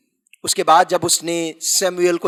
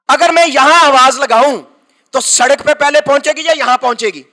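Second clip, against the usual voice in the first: impassioned preacher pushing the story forward; full-force preaching; 7 semitones higher, 6 dB louder than usual. Acted. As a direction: preach it out to the congregation.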